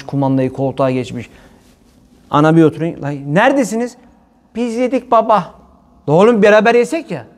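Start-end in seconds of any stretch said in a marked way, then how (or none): 3.0-7.3 raised, higher father's call; father calling the kids. Retold or imitated. Father calling his kids who left the table.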